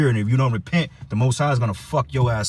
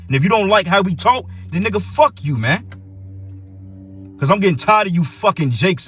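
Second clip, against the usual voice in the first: higher, heated defiance; angry challenge; 5 semitones higher than usual. Real, not acted. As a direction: dare them to object. Cursing out critics.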